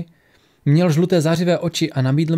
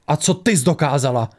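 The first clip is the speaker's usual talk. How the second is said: shouted retort. A narrator's reading. Failed woman challenging her critic, mildly shouted retort. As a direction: throw it back as a restrained cry. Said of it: "křikla Xigdiampe".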